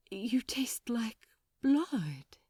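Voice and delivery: slow, breathless whisper; hushed whisper. Acted. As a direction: whisper it, stunned by the taste. Aziraphale tasting blood after the kiss.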